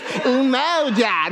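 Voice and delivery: "Umoja" in an exaggerated Michigander accent; mock American accent. Shown as a demonstration. Preacher mimicking congregation's attempt, mock American accent. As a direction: drawl "Umoja" wrongly, like a Midwestern American. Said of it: "Don't say it like you're American or Michiganders".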